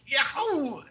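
very high squeal like a cartoon cat; cartoon yelp. Villain's fingers caught in the door, cartoon Tom and Jerry yelp. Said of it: "the most Tom and Jerry ass".

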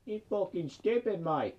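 high, drawn-out mock Australian jibe; exaggerated Australian accent, stretching the insult. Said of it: "All the Australians are like".

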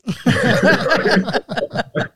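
a loud, high burst of laughter; big laugh. Real, not acted. Guest laughing at his own China answer, loud burst of laughter.

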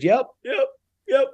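tight, high little yeps; high-pitched retold agreement; mimicked nervous assent. Mimics bawling client's assent.

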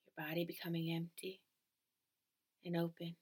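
calm, even relaxation-script delivery; guided meditation. Acted. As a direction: guide the listener gently into stillness.

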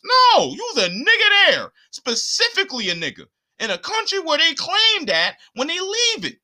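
high, heated correction; indignant rebuttal. Real, not acted. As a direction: cut in hard with soaring indignation. Heated rebuttal of the quoted claim.

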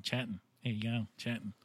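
Australian "Chatting" with the ending clipped to 'n. Shown as a demonstration. Host shows his guest the Australian way, Australian "Chatting". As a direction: say "Chatting" as casual Australian "chat'n". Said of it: "so there's the Australian".

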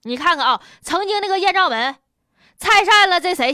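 ordinary talk

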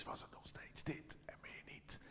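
demonstrating a voiceless whisper. Shown as a demonstration. Pastor showing the church his lost voice, whispering. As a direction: whisper it, as if that's all the voice left. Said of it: "Dat fluisteren kon ik nog".

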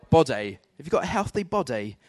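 put-on British accent on a preacher's call-out; fake UK accent. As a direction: play up a comic British accent. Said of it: "I do a fake little U K accent".

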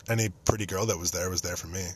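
usual speaking voice

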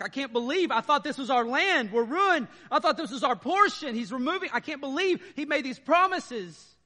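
high, loud mock wail of disbelief; mock lament. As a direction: ham up the sorrowful complaint. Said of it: "They're expressing".

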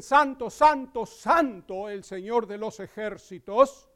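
"Santo, santo" rung out loud and slow; seraphim's proclamation. Acted. Pastor voicing seraphim crying holy to the congregation, loud. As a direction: cry "Santo" out like the angels in the temple. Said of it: "y dicen".

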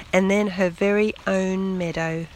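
ordinary delivery, nothing marked

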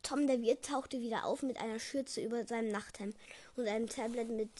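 usual speaking voice

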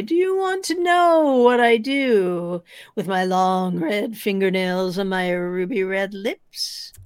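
eerie ghost voice. Acted. Storyteller voicing the ghost on the stairs, slow and eerie. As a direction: stretch each word like a ghost luring him in.

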